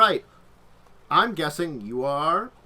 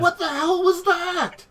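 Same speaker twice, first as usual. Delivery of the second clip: high, loud, drawn-out yell; hammed-up shriek from another room; startled scream; 6 dB louder, slower than usual. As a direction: scream it in shocked disbelief. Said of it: "as soon as I hear the scream".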